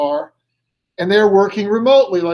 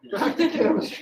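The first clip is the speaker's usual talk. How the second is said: higher, softer words through a laugh; 8 semitones higher, 6 dB quieter than usual. Real, not acted. Teacher turns back to the lesson after his joke, still laughing.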